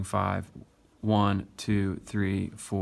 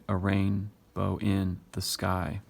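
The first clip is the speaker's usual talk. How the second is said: "A rain / bow in / the sky" in three slow chunks; counting out feet; slower than usual. Shown as a demonstration. Teacher counting three feet for the class, "A rain / bow in / the sky" slow and chunked. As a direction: cut "A rainbow in the sky" mid-word into three feet. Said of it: "There's three right here".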